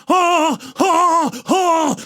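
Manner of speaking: high-pitched repeated "ah" cries; hammed-up high screams; mock female scream. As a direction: imitate a woman screaming in passion. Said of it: "she's screaming".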